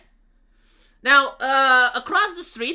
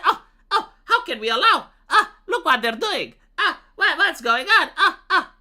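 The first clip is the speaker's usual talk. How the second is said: high, whiny mock wife with laughs; a hammed-up upset wife voice; 7 semitones higher, 4 dB louder than usual. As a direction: play the fussy wife, half laughing. Wife voiced upset about neighbors.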